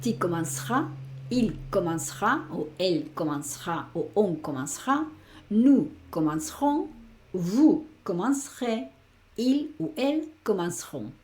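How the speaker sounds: teacher modelling French conjugation; French conjugation demo. Shown as a demonstration. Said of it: "esta E ni la escuchas prácticamente".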